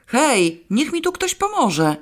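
rozdrażnione żądanie; irritated demand. A narrator's reading. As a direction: demand help, fed up. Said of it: "zażądała z irytacją Alicja".

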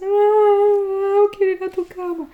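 put-on high, loud sobbing plea of a little girl; crying, shouting child. Daughter pleads with mother to sleep in her bed, high and crying. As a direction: beg like a terrified child after a nightmare. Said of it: "llorando y gritando".

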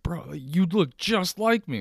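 high-pitched, drawn-out mock amazement; amazed insistence. Person insisting to their doppelganger, amazed. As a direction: pitch it way up and stretch it out. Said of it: "you're gonna lose your mind".

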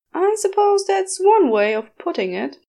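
high, loud prissy angel voice; prim and flustered reply; prim Aziraphale. A narrator's reading. Aziraphale primly answers the demon's crude remark.

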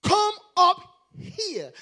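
high, loud and drawn out; slow booming summons; slow ringing command. Acted. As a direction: stretch each word like a proclamation. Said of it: "this should carry an exclamation".

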